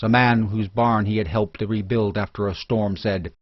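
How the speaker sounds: usual speaking voice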